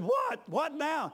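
high, loud startled question. Retold or imitated. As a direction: jump at the noise. Startled by Marvin shouting.

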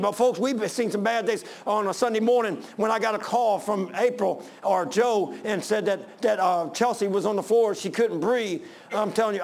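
ordinary talk, nothing marked

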